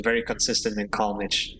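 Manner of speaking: ordinary talk, nothing marked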